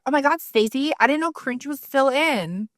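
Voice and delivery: high, gushing sarcastic gasp; mock valley girl. Mocking a hater with sarcastic high gushing. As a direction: gush over Stacey to mock her. Quoting her own mocking reply.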